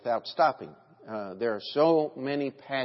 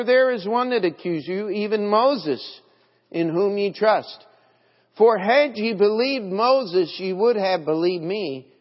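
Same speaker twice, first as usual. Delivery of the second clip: louder, higher ringing pulpit reading, slowing on key lines; preaching scripture; 4 semitones higher, 4 dB louder than usual. Acted. Preacher reading Jesus rebuking the Pharisees, full-force preaching. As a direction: proclaim Jesus's words to the Pharisees.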